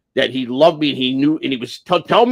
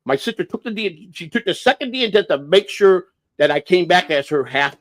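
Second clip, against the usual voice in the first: ordinary talk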